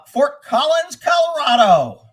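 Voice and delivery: slow, drawn-out announcer holler; loud call-out. Real, not acted. Streamer hailing viewers from Colorado, loud drawn-out shout. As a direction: holler it high and long.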